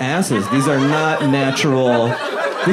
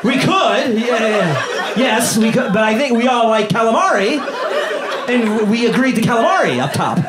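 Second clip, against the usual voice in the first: fake-polite objection; 8 semitones higher than usual. Mock polite argument at table.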